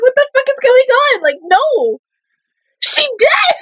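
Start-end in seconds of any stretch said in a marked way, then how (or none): 0.0-1.2 high-pitched mock bewilderment; imitated confusion. Imitating confused girls in the show, high bewildered squeal. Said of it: "looking as confused as I was". 2.8-3.6 very high squealed exclamation; shocked outburst. Real, not acted. Viewer reacting to the episode, shocked high squeal.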